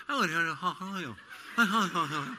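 laughing send-up of the lady's greeting, top lip kept still; lightly hammed-up lady in waiting; mock aristocratic lady. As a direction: imitate her fussing how are yous. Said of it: "she's going".

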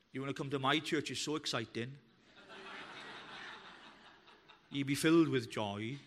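low, soft, deadpan mock advert; a flat, lifeless parody of an invitation; mock dull invite. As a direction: sell the church with zero enthusiasm. Mock bored church invite.

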